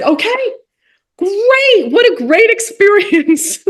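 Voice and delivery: high, slow, laughing delight; delighted reply. Retold or imitated. Replying to the rabbi's success, high delighted laugh. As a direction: gush with happy laughter.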